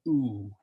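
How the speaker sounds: a drawn ooh; teacher's long U. Shown as a demonstration. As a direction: hold the ooh sound. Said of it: "a long U is as in a tuba".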